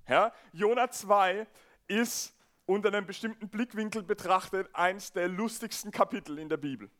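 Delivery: ordinary delivery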